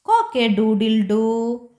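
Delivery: a lightly voiced rooster crowing, slow. Teacher voicing the rooster, slow stretched crow.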